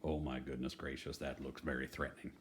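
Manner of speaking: ordinary delivery, nothing marked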